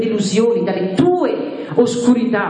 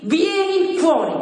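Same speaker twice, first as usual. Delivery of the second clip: high-pitched summons; crying out; 7 semitones higher than usual. Acted. Preacher lends Jesus a voice, crying out. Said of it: "che vuole gridare lui stesso".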